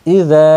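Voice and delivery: ইযা, lingering on যা; drawn-out final vowel. Shown as a demonstration. Teacher repeating the madd for learners, final vowel drawn out. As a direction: hold the last vowel of ইযা long. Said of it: "টানতে হবে".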